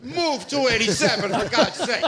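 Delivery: loud, high exasperated outburst; exasperated plea. Acted. Comedian rants about being stuck on two eighty-seven, loud exasperated plea. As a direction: plead loudly with the stuck traffic. Comic rant pleading over traffic jam.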